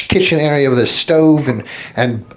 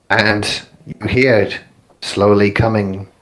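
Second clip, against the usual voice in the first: normal talking voice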